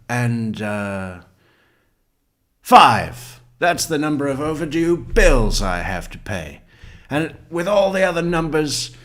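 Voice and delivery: loud, high, hammed-up movie tirade; Erin Brockovich. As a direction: fire off each number like a rebuke, building to the brush-off. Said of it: "when that biker moves in next door".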